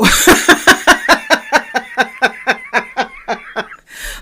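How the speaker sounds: a long, high burst of real laughter; big real laugh. Real, not acted. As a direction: let the laugh ring out. Laughing at her own punchline.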